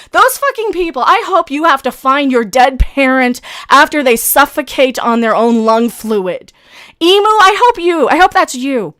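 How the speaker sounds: loud, bitter rant, pitch jumping up; furious cursing. Real, not acted. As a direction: spit curses at the bullies. Furious rant wishing trolls suffering.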